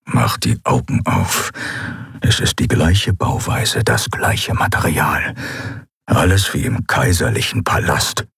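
calm knowing murmur from the next cell; low hushed Keru. Acted. Keru's voice continues.

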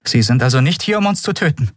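high-pitched, tentative question, climbing sharply; anxious question. A narrator's reading. Prisoner asks whether he came to kill them, anxious. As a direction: check warily that you are safe. Prisoner fears being killed.

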